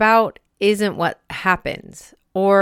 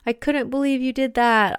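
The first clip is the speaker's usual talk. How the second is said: harsh inner critic; 6 semitones higher than usual. Retold or imitated. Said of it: "inner critic is like that super harsh coach".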